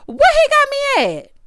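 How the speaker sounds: very high, loud mock Latrice; slow squealing Latrice impression; Latrice quoted. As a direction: shriek it slowly as drunk Latrice. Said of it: "Here go Latrice".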